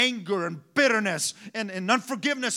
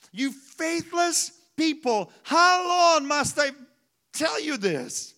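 higher, slow, weary exasperation; a higher, drawn-out fed-up rebuke; exasperated Jesus; 5 semitones higher, slower than usual. Retold or imitated. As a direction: sigh it out like a fed-up parent. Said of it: "It's like you tell your child".

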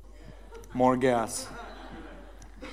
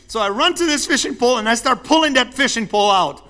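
ordinary delivery

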